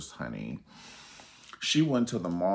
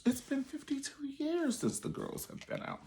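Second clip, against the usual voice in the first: lightly voiced high, squeaky complaint; squeaky mock exasperation; 5 dB quieter than usual. Complaining about the endless feud in a high, squeaky voice. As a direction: pitch it way up in mock despair.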